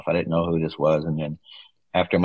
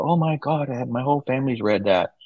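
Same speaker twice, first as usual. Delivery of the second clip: lightly mimicked gushing server, voice pitched up; excited reply; 7 semitones higher than usual. Florida-born server gushing to the author's son about the book, high and excited. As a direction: play the server's delighted recognition.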